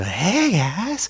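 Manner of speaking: high put-on greeting voice; Doctor Rock. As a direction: chirp it in a different voice. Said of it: "talking like Doctor Rock".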